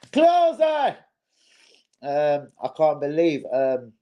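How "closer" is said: high loud put-on shout; shouted call. Calling out to chat, loud high shout. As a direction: belt it out.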